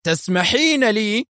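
slow, high, incredulous question; outraged cry. A narrator's reading. Husband scoffs at his wife permitting him, outraged. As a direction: draw it out in outrage, without real shouting. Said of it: "صرخ".

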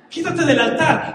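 play-acted excited shooing; acted eager order. Acts Abraham ordering Isaac off altar.

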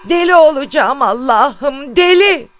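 loud, high, drawn-out cry of despair; desperate shout. A narrator's reading. Sleepless woman crying to God, desperate shout. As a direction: cry out slowly, climbing to a shriek on the last word. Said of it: "diye bağırdı".

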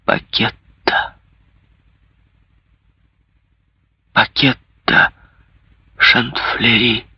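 slow, hushed calling. A narrator's reading. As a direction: call gently, slowly. Softly calls the recluse.

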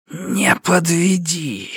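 slow, high hissing shadow voice; creeping nightmare whisper. Acted. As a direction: hiss it like a creature of darkness. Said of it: "прошипело порождение тьмы".

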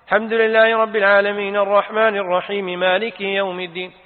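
string the verses together without stopping to breathe; in one breath. Shown as a demonstration. Showing how the imam links the verses, in one breath. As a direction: recite "الحمد لله رب العالمين الرحمن الرحيم مالك يوم الدين" unbroken, in a single breath. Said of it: "وصلهما بنفس واحد".

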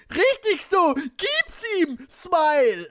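very high, loud falsetto cheer. Acted. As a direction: egg him on in a squealing put-on voice.

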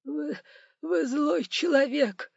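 high, slow sobbing voice; tearful high-pitched wail. A narrator's reading. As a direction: accuse through whimpering sobs. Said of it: "всхлипывал управляющий".

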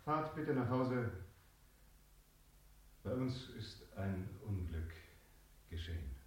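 hushed, grief-stricken words; grieving. Real, not acted. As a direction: tell them the terrible news.

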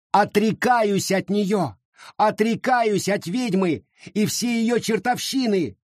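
high, slow fervent vow; fervent renunciation. A narrator's reading. Charity renounces the witch before the crowd, high and fervent. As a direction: renounce her with zeal.

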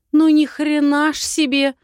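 high, loud outraged cry; outraged astonishment, voice shooting up; indignant exclamation. Acted. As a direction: exclaim in disbelief. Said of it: "воскликнула я".

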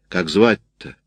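mildly brusque question with some softness; soldierly bluntness, gently softened; gruff yet soft. A narrator's reading. Canteen woman asking a frightened mother's name, gruff yet soft. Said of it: "прозвучал по-солдатски грубо, в нём чувствовалась женская мягкость".

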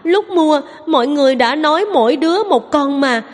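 put-on high, tearful child's protest; crying little girl. A narrator's reading. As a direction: whine it out like a wronged little girl. (giọng bé gái mếu máo, cao vút)

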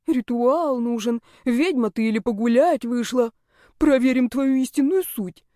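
mimicked coaxing. Heroine recalls her friend talking her into a ritual, mimicked coaxing. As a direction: mimic someone egging you on. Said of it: "вспомнила уговоры Яни".

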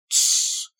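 sharp unvoiced shh; hissing shush. A narrator's reading. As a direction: hush her with a hiss. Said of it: "зашипела она".

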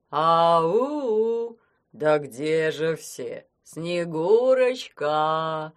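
loud, very slow worried calling; worried Grandfather Frost. Acted. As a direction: call out anxiously into the dark. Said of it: "послышалось беспокойство".

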